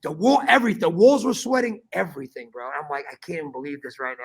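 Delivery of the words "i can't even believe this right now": exhausted mutter. Retold or imitated. Overwhelmed by the heat.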